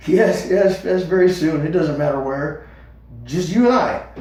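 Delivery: loud, high, frantic pleading; he must convince him now; urgent Fiver. A narrator's reading. Fiver begging Hazel to leave, high and loud.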